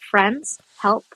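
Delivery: ordinary talk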